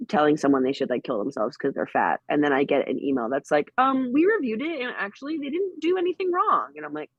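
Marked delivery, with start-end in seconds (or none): 3.8-6.7 high, prissy corporate reply; mock support voice. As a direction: read it like a smug customer service bot. Mocking the Twitter support email.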